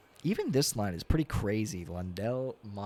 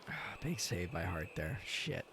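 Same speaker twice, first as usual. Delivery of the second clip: ordinary talk, nothing marked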